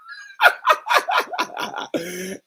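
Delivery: high real laugh cracking up at the punchline; burst of laughter. Real, not acted. Storyteller laughing at the classmate's line. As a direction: break into a laugh.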